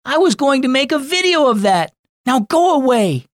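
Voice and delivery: put-on high, loud boyish outburst; furious kid yelling at his sister; angry shout. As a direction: snap at your sister in anger. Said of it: "Kai shouted".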